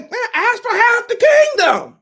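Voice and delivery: high, loud, wild cry of the mother; hammed-up shouted mother; mock mama shout. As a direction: yell it like the scheming mother egging her on. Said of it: "the mama would be like".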